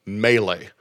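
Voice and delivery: ordinary delivery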